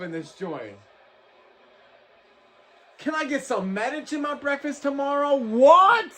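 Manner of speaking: high-pitched, amazed, drawn out; stunned excitement. Real, not acted. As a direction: stay stunned by the knockout. Shocked after the knockout.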